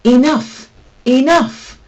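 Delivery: sharp high shout to stop him. A narrator's reading. Cat mentor shouting at pupil to stop. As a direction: bellow it to halt the attack. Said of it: "Jalal was shouting".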